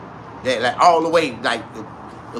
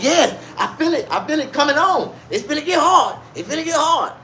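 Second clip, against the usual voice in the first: put-on old man voice; 10 semitones higher than usual. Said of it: "He like".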